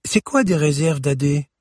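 put-on high, eager child's question; curious little girl. A narrator's reading. Girl asks her grandfather about the reserves: curious child. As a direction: ask like a curious young girl.

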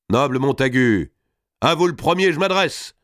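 high, declaimed address rising at the end; single out a lord before the hall; theatrical, proclaiming call; formal challenge. Acted. Robert formally challenges Salisbury at table.

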